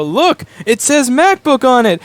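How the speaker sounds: a hyped-up high pitch, slowed on MacBook; mock salesy pitch. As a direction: pitch the junk like a prize. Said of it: "and go".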